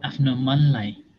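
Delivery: measured instructions with long silences; guided meditation. Real, not acted. Teacher guiding students to observe the body, guided meditation. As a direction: guide them step by step, pausing between phrases.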